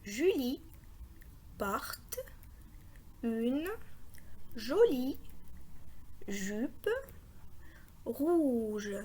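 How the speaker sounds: long pause after every word; isolated words, slowly spaced out. Shown as a demonstration. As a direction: read each word separately for beginners. Models reading one word at a time.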